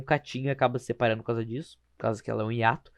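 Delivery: normal talking voice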